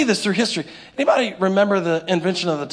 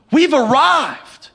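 mock triumph; 4 semitones higher, 5 dB louder, much slower than usual.

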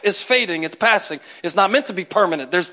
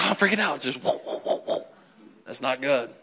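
breathless whispered whoa, whoa; preacher miming a churchgoer's quiet panic, whispered; mock panic whisper; 7 semitones lower, 9 dB quieter than usual. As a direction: hush it like a panicked aside. Acting out freaking out.

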